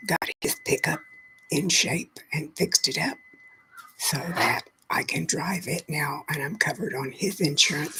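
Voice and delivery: ordinary delivery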